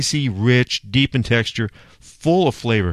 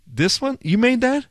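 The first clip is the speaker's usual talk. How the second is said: high, incredulous double-take; stunned disbelief; 13 semitones higher than usual. Retold or imitated. To Afaf, amazed she grilled it herself, high disbelief. Stunned reaction to friend's cooking.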